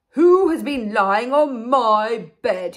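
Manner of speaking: big, slow booming bear voice; very loud Father Bear. Acted. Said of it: "in a very loud voice".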